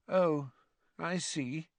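soft, sulky and high; morose. A narrator's reading. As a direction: let it drop morosely. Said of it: "in a morose sort of way".

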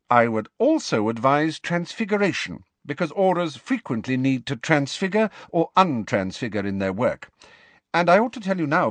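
high-pitched, emphatic careers talk; stern professor. Acted. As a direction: advise a pupil with firm authority. McGonagall advising Potter.

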